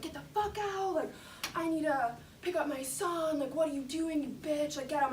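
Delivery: high, mock furious yelling at the mom; imitating an angry yell. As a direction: play the rude stranger yelling to get out. Woman in elevator yells at mom.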